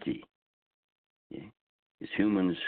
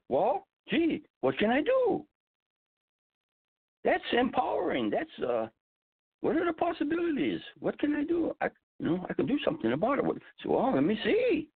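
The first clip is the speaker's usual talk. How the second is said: bright, much higher, lifted voice; hopeful. Retold or imitated. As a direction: lift the voice with new hope.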